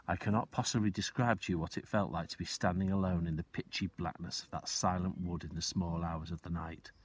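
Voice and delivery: ordinary delivery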